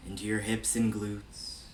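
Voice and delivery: measured meditation-guide voice with long pauses between phrases; evenly projected, spacious meditation delivery; guided meditation. Real, not acted. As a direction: lead a body scan, unhurried and steady. Leads a body scan meditation.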